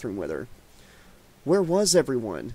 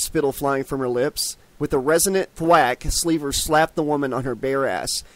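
ordinary delivery, nothing marked

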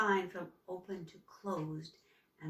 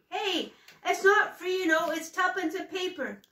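high, loud street call; loud newsboy shout; 10 semitones higher, 10 dB louder than usual. Acted. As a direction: shout it across the street to the thief. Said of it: "Finn's voice".

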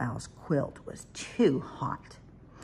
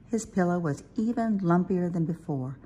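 usual speaking voice

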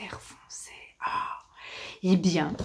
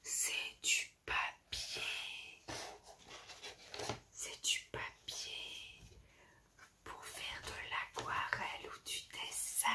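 whispering; 19 dB quieter than usual. Real, not acted. Unwrapping, hushed.

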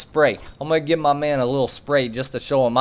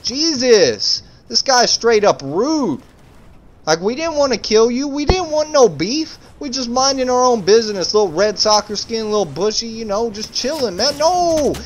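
high, wailing complaint with stretched words; indignant mock protest; 7 semitones higher than usual. Player complaining that his friend just wanted to fish, indignant wail. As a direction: plead the injustice in a high whine.

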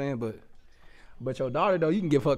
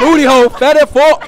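loud, higher shouted blurt; shouting; 10 semitones higher, 17 dB louder than usual. Real, not acted. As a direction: yell it across the group. Shouted joke interjection.

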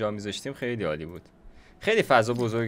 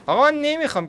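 much higher and louder, real exasperation; exasperated outburst; 8 dB louder than usual. Real, not acted. Frustrated with the shape.

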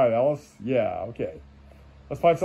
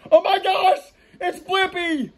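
high, squealed fake astonishment; mock amazement; 14 semitones higher, slower than usual. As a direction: gasp it out in a silly high voice. Mock amazement at cartoon character.

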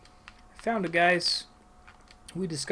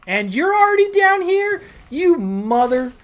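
very loud, high shrieking fury; staged rage; 7 semitones higher, 8 dB louder than usual. Acted. Gamer screaming at the enemy healer, theatrical fury. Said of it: "put a little more, you know, theatrics".

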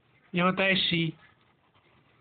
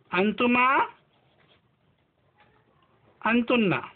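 each pronoun said slowly and clearly, long pauses between; slow pronoun drill; 4 semitones higher, slower than usual. Shown as a demonstration. Teacher drills Arabic pronouns for students, slow and deliberate. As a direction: call out each word for the class to repeat.

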